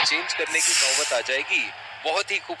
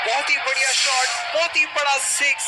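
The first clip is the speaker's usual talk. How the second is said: raised, thrilled call; excited; 7 semitones higher than usual. Real, not acted. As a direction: call the six with delight. Six hit, commentator reacts.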